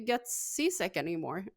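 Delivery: ordinary delivery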